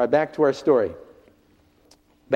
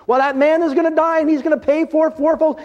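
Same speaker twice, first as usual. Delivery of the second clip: high, loud outraged outburst; outraged king; 12 semitones higher, 5 dB louder than usual. Retold or imitated. Preacher voicing King David, loud and outraged. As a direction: voice King David's fury at another man's sin. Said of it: "He was outraged at the behavior".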